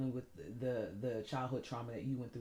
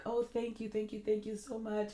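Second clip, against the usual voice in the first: bright, higher put-on gratitude, hiding how she feels; fake cheerful thanks; 6 semitones higher than usual. Thanking birthday well-wishers, putting on a higher cheerful voice. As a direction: act overjoyed while feeling empty inside. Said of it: "I will always be like".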